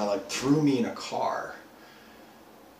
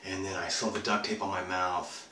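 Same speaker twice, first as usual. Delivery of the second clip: plain reading voice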